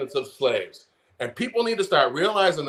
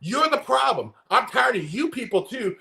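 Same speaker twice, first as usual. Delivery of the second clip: heated, higher ranting voice; worked-up rant; 5 semitones higher than usual. Real, not acted. Ranting at former Republicans on TV, fired up. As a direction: raise it up, angrier with each line. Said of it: "Sorry, getting worked up today".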